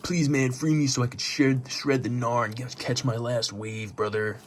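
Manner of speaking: hammed-up surfer-bro plea; pleading surfer. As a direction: beg like a stoner surfer who needs one last wave. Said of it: "he's like".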